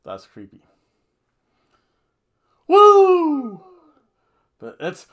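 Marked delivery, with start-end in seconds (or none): 2.7-3.6 shrill, very high, loud woo; Ric Flair woo. Retold or imitated. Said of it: "Ric Flair, though".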